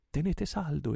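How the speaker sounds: high, urgent order; exclaiming. A narrator's reading. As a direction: urge them to hold firm. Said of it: "esclamò il portoghese". (esclamazione energica e acuta)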